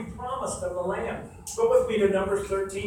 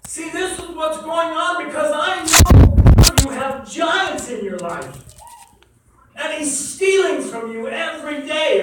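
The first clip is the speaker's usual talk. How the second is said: fervent, building preacher voice, often louder; preaching at full force; 5 semitones higher, 6 dB louder than usual. Acted. Pastor urging his congregation, at full preaching force. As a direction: preach it, pressing every line home.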